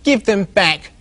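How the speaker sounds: high, pleading little pig squeal; little pig squealing up at the squirrel. Acted. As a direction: squeal for the nuts back. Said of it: "squealed the little pig".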